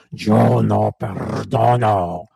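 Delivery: deep slow demon voice refusing to forgive; demonic voice. Shown as a demonstration. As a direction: drag each word out like the devil speaking. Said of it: "la voz de la mujer que no perdona suena así".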